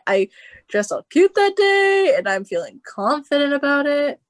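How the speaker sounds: sing-song, high and pleased with yourself; playful sing-song boast. Real, not acted. Recalls feeling cute and confident.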